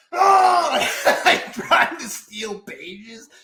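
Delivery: a high, loud "Oh!" into laughter; excited yell. Retold or imitated. Trainers diving for the client list, shouted and laughing. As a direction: cry out like a grabbing crowd.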